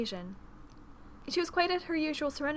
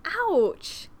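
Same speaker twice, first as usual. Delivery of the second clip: loud, high cry of pain; sharp exclamation of pain; pained yelp; 8 semitones higher, 7 dB louder than usual. A narrator's reading. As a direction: yelp as if your foot was trodden on. Hurt during the dance lesson.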